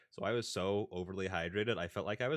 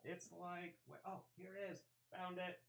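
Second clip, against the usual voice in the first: far from mic; 8 semitones higher, 15 dB quieter than usual. Real, not acted. Speaking away from mic.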